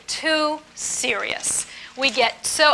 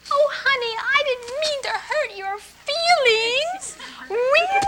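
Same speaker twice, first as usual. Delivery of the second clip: put-on much higher, sugary little-girl voice, slower on the apology; cutesy little-girl act, pitched way up; 11 semitones higher, slower than usual. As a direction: play Little Miss Muffet sweet-talking honey. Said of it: "we play Little Miss Muffet".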